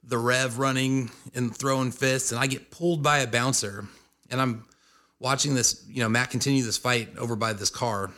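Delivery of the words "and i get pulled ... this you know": usual speaking voice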